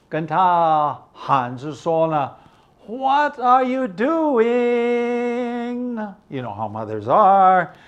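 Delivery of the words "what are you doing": mock mother calling high. Mother calling from the kitchen window, high mock call. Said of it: "opened the window and shouted".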